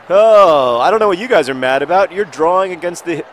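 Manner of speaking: high, loud commentator's outcry; loud protest. Real, not acted. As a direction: protest loudly over the match. Reacts to a goal against him.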